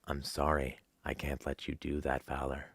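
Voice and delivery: low, soft, calm computer voice; cold, polite machine refusing; HAL 9000 quote. Acted. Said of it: "HAL quote in low voice".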